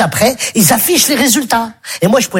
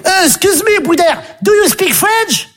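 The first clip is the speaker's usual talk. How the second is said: mock schoolkid; 7 semitones higher than usual. Pupil mocking his classmate in English class. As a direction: play the cheeky pupil.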